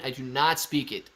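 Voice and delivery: ordinary talk, nothing marked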